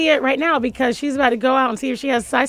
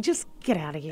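muttered aside; 8 semitones lower, 12 dB quieter than usual. Acted. Waving mom away.

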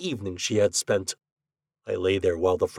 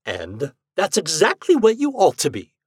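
lightly voiced scolding ghost, higher and louder; a hint of a horrid, raised voice; horrible voice; 8 semitones higher, 5 dB louder than usual. Ghost scolds the husband from across the room, mildly horrible. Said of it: "said that horrible voice".

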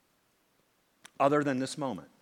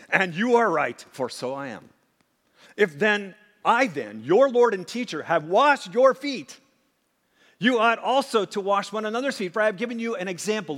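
loud, slowed, emphatic scripture reading; preaching Jesus' words; 6 semitones higher, 7 dB louder than usual. Acted. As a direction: proclaim it to the congregation, deliberately.

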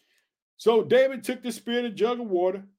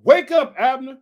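loud, raised wake-up call; shouting down; 3 semitones higher, 7 dB louder than usual. A narrator's reading. Preacher re-reading David's call to sleeping Abner, shouted. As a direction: holler it to rouse a sleeper. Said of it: "he shouted down to the soldiers".

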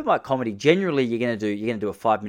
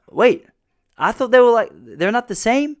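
confused surprise; 12 semitones higher, 9 dB louder, slower than usual. Retold or imitated. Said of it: "He's like".

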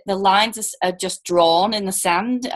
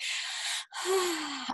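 soft, drawn-out breathy sigh; slow exhale, acted for effect; long sigh; 8 semitones higher, 14 dB quieter than usual. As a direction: let out a long, quiet sigh. Acts out relief at soothing updates.

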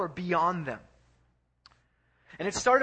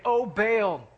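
loud, raised invocation; calling out; 5 semitones higher, 7 dB louder than usual. Retold or imitated. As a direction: call out to a god, higher and louder. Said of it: "they begin to call out".

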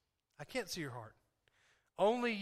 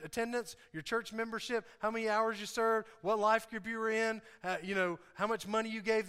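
booming motivational speech; 4 semitones higher than usual. Acted. Preaching at full force.

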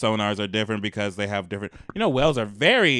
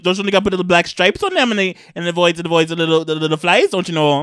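loud, higher cartoon Canadian accent; Minnesota-Canadian accent; 6 semitones higher, 7 dB louder, faster than usual. Advising on stripes against flies, Minnesota-Canadian accent. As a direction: stumble folksily through a hammed-up northern accent. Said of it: "The, the Canadians".

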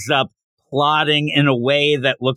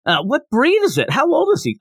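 much higher, bubbly schoolgirl questions; eager Becky voice; 11 semitones higher than usual. Retold or imitated. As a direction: gush like an excited little girl. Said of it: "And she's like".

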